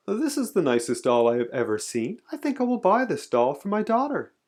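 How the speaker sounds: a hint of delighted shopper; pleased, lilting pitch that climbs; admiring lady shopper. A narrator's reading.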